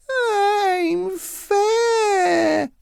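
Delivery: very high, very slow crying whine; squeaky crying protest, very slow; whining giant son. Acted. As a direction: wail it like a child denied supper. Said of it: "His already high-pitched voice went up a few octaves".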